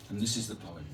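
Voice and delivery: usual speaking voice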